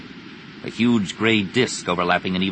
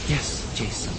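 soft reply; 4 semitones higher, 6 dB quieter than usual. A narrator's reading. Bergere secretly comforts Jason while weeping.

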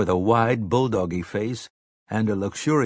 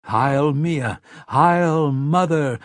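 higher, louder chanted hail; ringing, raised salute; crowd's cry; 5 semitones higher, 4 dB louder, much slower than usual. A narrator's reading. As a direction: answer as a cheering crowd, drawn out. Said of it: "the rest cried".

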